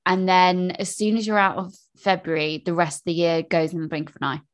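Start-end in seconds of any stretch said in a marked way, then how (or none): none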